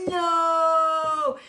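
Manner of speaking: long, high, loud mock wail; horrified wail. Children learning it is shepherd's pie day, hammed-up horrified wail. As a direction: draw it out in melodramatic horror.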